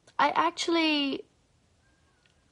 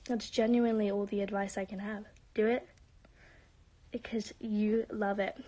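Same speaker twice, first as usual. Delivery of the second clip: ordinary talk, nothing marked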